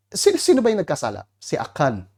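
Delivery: usual speaking voice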